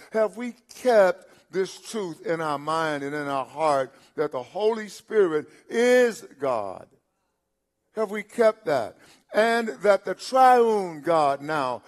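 loud, emphatic sermon delivery; forceful preaching. Real, not acted. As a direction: drive it home slowly to the congregation, loud and ringing.

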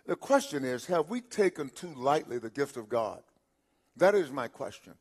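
usual speaking voice

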